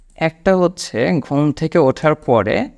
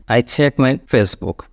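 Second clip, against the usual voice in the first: slow model sentence; 3 semitones lower, much slower than usual. Shown as a demonstration. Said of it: "ওই জায়গাটা আপনি বলেন".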